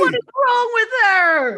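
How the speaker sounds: high, loud mock outrage at the screen; shouted disbelief. Host imitating teenagers at the cinema, high outraged shout. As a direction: yell it like a heckling moviegoer.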